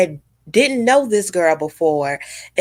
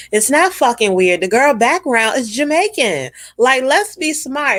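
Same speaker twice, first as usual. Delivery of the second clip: higher and sharper, exasperated; angry rant; 6 semitones higher, 3 dB louder than usual. Real, not acted. Scolding the bloggers who called it weird, exasperated. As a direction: scold them like they should know better.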